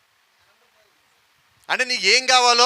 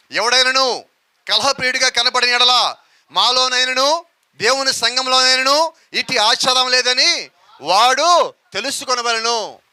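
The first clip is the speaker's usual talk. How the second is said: slow, louder formal Bible reading; solemn scripture reading; 3 semitones higher, 4 dB louder, slower than usual. Acted. The preacher reads verse sixteen to the church, slow and solemn. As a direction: weigh every word of the verse.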